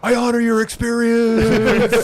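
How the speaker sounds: high, slow, laughing mock sincerity; mock solemn. Podcast host mocking the movie's earnest line, high and slow. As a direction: stretch it out like a hammed-up earnest actor.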